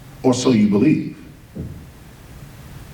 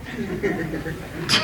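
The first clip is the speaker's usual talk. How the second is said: soft breathy laugh; 7 dB quieter than usual. Real, not acted. Laughs at Jesus couldn't read.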